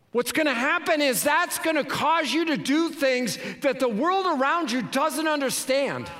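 high, loud climactic preaching; full-force preaching. Acted. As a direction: drive it home to the congregation.

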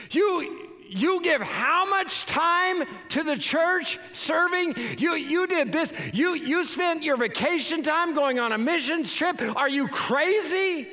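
high, slow incredulous mocking; mocking disbelief. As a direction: scoff at the churchgoer. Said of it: "voicing the world's mockery".

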